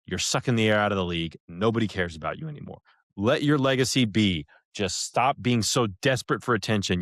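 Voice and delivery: normal talking voice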